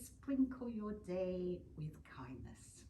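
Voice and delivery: slow, whispered saying. Acted. Teacher sharing the day's quote with class, slow whisper. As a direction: breathe the quote out gently and slowly.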